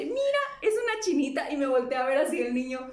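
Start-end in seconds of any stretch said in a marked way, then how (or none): 0.0-1.5 put-on high-pitched little kid voice; put-on childish wonder, pitched way up; imitated excited child. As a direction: point it out like an amazed child. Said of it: "Y entonces le dice".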